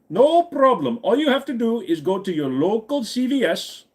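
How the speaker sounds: put-on high-pitched Indian-accented tech support scammer. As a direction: reassure the victim in a singsong Indian accent. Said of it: "your Indian accent is hilarious".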